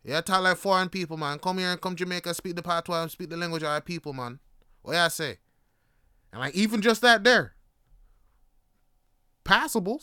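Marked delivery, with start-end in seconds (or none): 0.0-5.4 put-on a light Jamaican accent in his own voice. A light Jamaican accent for locals teasing his speech. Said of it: "they'll go like".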